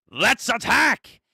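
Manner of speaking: high, loud, drawn-out attack order; battle call. Acted. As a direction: call the crew to charge. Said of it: "made the official call".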